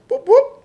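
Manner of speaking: two loud, high siren whoops; imitated police siren. Retelling police hitting the siren, imitated high whoops. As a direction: mimic a quick double siren blip. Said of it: "I heard them hit it".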